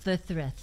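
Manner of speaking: "Cercerus" lisped, s's turned to th. Pretend. Co-host teases a hard word for people with lisps, lisped "Cercerus". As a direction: say "Cercerus" with th for every s. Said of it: "for all you people with lisps".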